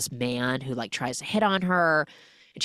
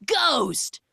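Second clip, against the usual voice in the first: gleeful refrain; 10 semitones higher than usual. Pretend. Punchline refrain over montage.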